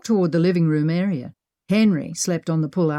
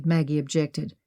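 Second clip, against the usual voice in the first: ordinary delivery, nothing marked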